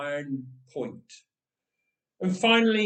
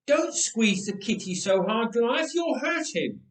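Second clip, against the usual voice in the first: parent's scold; 7 semitones higher, 3 dB louder than usual. Retold or imitated. Parent scolding young Goliath.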